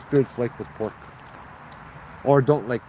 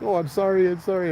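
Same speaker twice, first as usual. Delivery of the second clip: retold apology; 8 semitones higher than usual. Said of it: "the guy's like".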